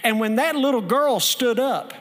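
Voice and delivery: loud, rising revival preaching; fiery pastor preaching the story's payoff; preaching at full force. Acted. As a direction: build it up to a shouting climax.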